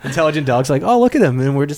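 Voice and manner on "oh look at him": high cooing over a tiny human; cooing dog voice. Pretend. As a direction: coo like it's adorable.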